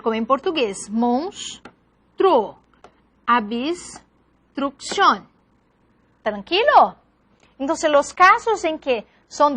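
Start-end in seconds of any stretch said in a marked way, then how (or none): 0.9-5.3 "Monstro. Abstrucción" slowly split with long gaps; "Monstro. Abstrucción" broken after the s; syllable division. Shown as a demonstration. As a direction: say mons-truo, abs-trucción for "Monstro. Abstrucción". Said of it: "las otras dos se unen".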